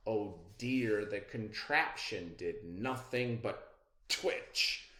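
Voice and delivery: ordinary delivery, nothing marked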